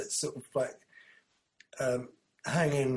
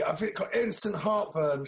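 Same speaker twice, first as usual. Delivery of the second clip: normal talking voice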